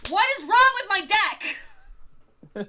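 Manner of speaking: high, loud, slow despairing cry; exasperated wail. Retold or imitated. The unlucky opponent to himself, a loud slow despairing wail. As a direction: wail it like the deck betrayed you. Retold player despairing at his deck.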